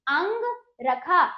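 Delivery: अंग रखा slowly, pausing between the parts; measured teacher's demo of अंग रखा; model pronunciation. Shown as a demonstration. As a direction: separate अंग from रखा for pupils to repeat. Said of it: "बोलिए".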